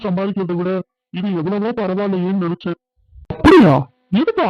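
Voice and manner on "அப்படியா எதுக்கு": loud, high-pitched startled question; sharp jump up in pitch and volume; shocked surprise. Acted. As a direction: burst out in disbelief. Hears of the risky investment.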